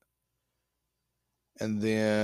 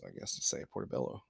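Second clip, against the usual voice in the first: whispered aside; 10 semitones lower, 12 dB quieter than usual. Real, not acted. Trailing aside, unvoiced.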